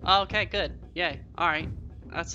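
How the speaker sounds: usual speaking voice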